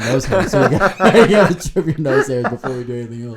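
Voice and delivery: laughing through the words. Real, not acted. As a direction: giggle as you speak. Laughing while joking.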